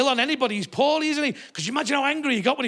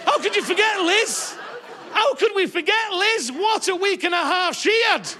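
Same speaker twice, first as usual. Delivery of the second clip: sarcastic audience; 5 semitones higher than usual. Retold or imitated. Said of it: "Half the audience went".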